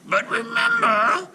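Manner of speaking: high, slow cackling witch; old witch voice. A narrator's reading. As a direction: warn her like a crooked old hag. Baba Yaga voiced.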